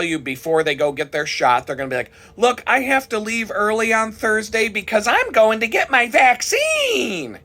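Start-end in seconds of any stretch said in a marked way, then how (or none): 2.4-7.4 high mock announcement, slowing right down on vaccine; mocking coworker. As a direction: proudly announce it in a prissy put-on voice.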